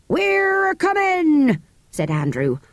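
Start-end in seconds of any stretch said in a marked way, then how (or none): 0.1-1.6 high, slow rustic call. Acted. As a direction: answer up the tower. Andrew calling up.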